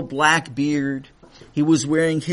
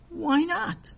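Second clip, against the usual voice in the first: puzzled; 13 semitones higher, 4 dB quieter than usual. Acted. Man puzzled by refusal.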